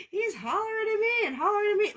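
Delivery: high laughing squeal. Real, not acted. Laughing at fussing cat.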